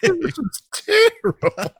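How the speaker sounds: high and slow through laughter, mimicking the girl; laughing child impression; high, slow kid imitation. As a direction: play the daughter, high and drawn out. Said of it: "voicing daughter again".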